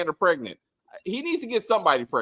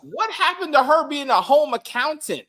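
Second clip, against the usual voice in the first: incredulous; 11 semitones higher, 5 dB louder than usual. Real, not acted. Mock outrage at character's new job.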